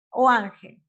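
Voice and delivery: "ángel" hit loud on ÁN; stress on ÁN. Shown as a demonstration. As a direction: punch the first syllable of "ángel". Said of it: "las graves o las llanas".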